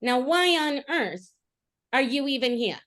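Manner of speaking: high, loud taunting scorn; mocking berating. Bard mocking the riders to stun them, high taunting scorn. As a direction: sneer at them as a show-off. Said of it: "just starts berating them".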